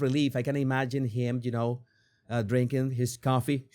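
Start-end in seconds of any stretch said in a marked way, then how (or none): none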